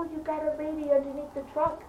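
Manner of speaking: high, held-back mock yell; urgent retold shouting. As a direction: imitate neighbours calling out the alarm. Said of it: "They go".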